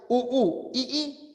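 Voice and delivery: teacher splitting each vowel pair into two beats; vowels split apart. Shown as a demonstration. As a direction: sound u, u and i, i as separate syllables. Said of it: "Si las cerradas son iguales".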